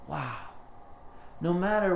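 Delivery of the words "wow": ordinary talk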